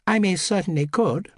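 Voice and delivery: put-on crisp posh vowels of a young lady; young upper-class voice. Housemaid showing a young aristocrat's voice, cut-glass English. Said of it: "cut-glass perfection of the housemaid's vowels".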